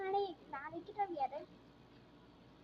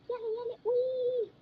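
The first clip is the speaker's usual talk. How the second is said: comic yelp; 6 semitones higher, 8 dB louder, slower than usual. Real, not acted.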